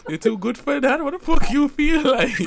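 squeaky high mocking voice. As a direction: tease in a high shrill-pitched put-on voice.

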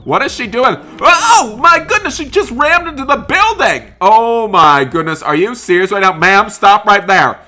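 loud, high cries of alarm; acted panic, voice leaping up; shocked shouting. Reacting to car ramming building.

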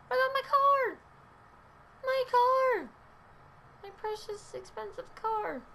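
high-pitched playful shriek easing into a whimper; mock wailing. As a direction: squeal over your car, hammed-up. Mock distress over the game car.

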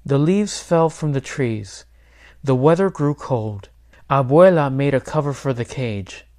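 usual speaking voice

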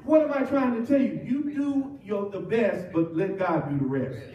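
ordinary talk

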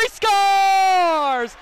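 drawn-out shouted goal call; long goal yell. Real, not acted. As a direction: stretch scores out loud.

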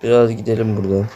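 normal talking voice

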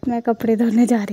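loud announcement across the house; calling out. Real, not acted. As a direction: call out loudly on the way off. Calls out on leaving for laundry. (जाते हुए ज़ोर से बताना)

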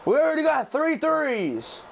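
ordinary talk, nothing marked

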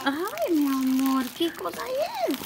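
high, stretched-out cooing; doting wonder. Real, not acted. Mother marvels as her child unwraps a gift, doting wonder.

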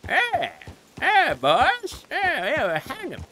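squeaky high cheerful guy voice; Terrence Waters. Retold or imitated. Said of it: "Terrence Waters impression".

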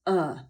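E as a low, dull "uh". Shown as a demonstration. Teacher shows the third vowel, low dull "uh" for E. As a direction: drop the pitch and say E as a short "uh". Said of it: "i trzecie to niskie".

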